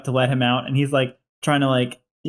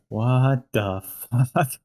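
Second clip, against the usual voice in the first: stunned; much slower than usual. Acted. Tom stunned by the outburst.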